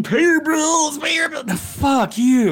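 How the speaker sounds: mocking Trump.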